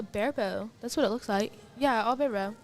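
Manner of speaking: normal talking voice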